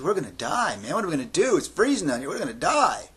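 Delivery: high, rising-and-falling frantic wail; panicked. Acted. Stranded teen tells his companion they'll freeze, panicking. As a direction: whine it in a mild panic, high and a little loud.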